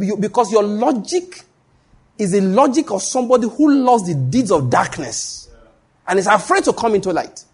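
nail him with the accusation; preacher thundering an accusation. Retold or imitated. Accusing the man loudly.

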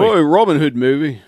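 loud, high mock Australian "Oi"; Russell Crowe accent. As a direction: bark it like a hammy Aussie bloke. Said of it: "I say I can't even do Australian".

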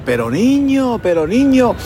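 high-pitched, lightly acted weeping aunt; imitating a tearful, worried relative; mock tearful pleading. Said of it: "se echó a llorar".

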